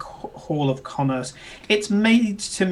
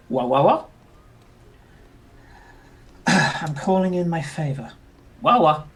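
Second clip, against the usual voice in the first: comlink voice; 4 dB louder than usual. Acted. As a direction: voice over a radio comlink. NPC voice through the comlink.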